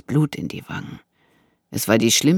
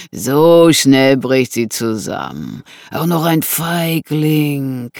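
slow, drawn-out scornful menace; menacing intruder; 6 dB louder than usual. Acted. Intruder with knife advises Annegret to comply, menacing. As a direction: gloat slowly over her collapse.

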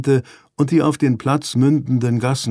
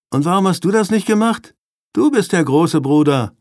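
higher-pitched, defiant comeback; 6 semitones higher, 3 dB louder than usual. A narrator's reading. Younger brother blamed for not watching their sister, retorting defiantly. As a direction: throw the blame back stubbornly. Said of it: "gab Ruben trotzig zurück".

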